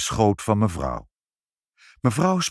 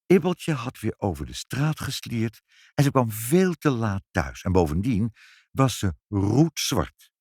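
plain reading voice

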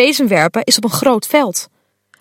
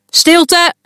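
higher, loud command; calling for silence; 6 semitones higher, 3 dB louder than usual. Acted. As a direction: hush the crowd firmly. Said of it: "roept Minou".

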